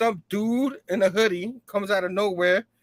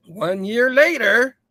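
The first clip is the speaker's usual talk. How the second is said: dramatic caption; 4 semitones higher, 6 dB louder, much slower than usual. Acted.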